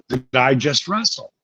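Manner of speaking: softly confused "Did I just wrestle?" said low and hushed; dazed, quiet question. Retold or imitated. Concussed wrestler quietly asks his wife, lost and hushed. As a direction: ask it low, like you just woke up.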